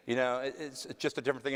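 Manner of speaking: normal talking voice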